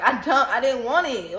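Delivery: normal talking voice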